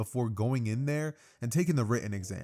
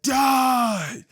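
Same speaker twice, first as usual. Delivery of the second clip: very high, very loud drawn-out scream; full-force crazed battle yell; maniacal scream; 12 semitones higher, 9 dB louder than usual. Acted. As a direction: scream it like a berserk attacker. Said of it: "just start screaming".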